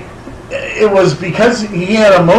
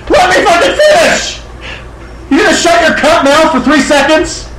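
heated, raised voice; snapping at a friend mid-debate; angry rant; 7 semitones higher than usual. Real, not acted. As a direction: tell him off hard. Heated argument, telling friend off.

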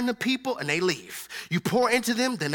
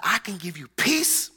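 calm promise; 2 semitones lower, slower than usual. Acted. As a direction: speak peace slowly and gently. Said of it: "he told us in his word".

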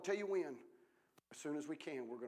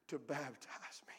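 humble whisper; 2 semitones lower, 4 dB quieter than usual. Retold or imitated. Preacher voicing John's humble plea to Jesus, whispered. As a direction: fade into a whisper.

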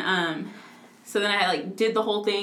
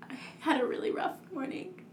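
soft, higher, imitating someone upset; imitated tearful confession; 5 semitones higher, 10 dB quieter than usual. Imitating the crying boss confessing, soft and upset. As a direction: retell it small and hushed. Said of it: "then she just starts crying".

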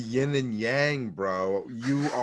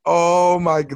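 louder, exasperated groaning at the host; acted-out frustration, pushed louder; exasperated listener; 3 semitones higher, 6 dB louder, slower than usual. Listener reacting to a podcast mistake, exasperated.

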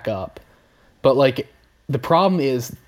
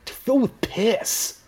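higher, slow, venom-laced nature narrator; venomous Attenborough; 9 semitones higher, 5 dB quieter, slower than usual. Retold or imitated. Mocking the narrator's tone for the guys, venomous. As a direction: sneer it like a bitter documentary host. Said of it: "He has a lot of venom towards them".

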